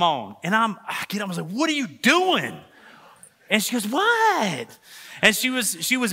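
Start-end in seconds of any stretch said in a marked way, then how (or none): 1.5-2.6 high, drawn-out, incredulous question; exasperated retelling. Retold or imitated. Husband to wife when all the lights come on, exasperated. 3.9-4.8 high-pitched, drawn-out mock-innocent "what"; imitated wife's reply. Wife answering her husband about the lights, imitated innocent reply.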